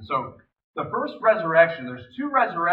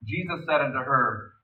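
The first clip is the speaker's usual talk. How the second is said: normal talking voice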